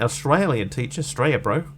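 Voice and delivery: exaggerated Australian accent; broad mock Australian vowels. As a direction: lay on a matey Australian twang. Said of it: "an Australian".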